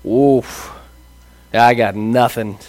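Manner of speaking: deflated student. Retold or imitated. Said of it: "you're like".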